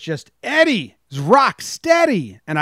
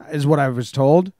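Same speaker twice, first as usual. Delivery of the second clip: usual speaking voice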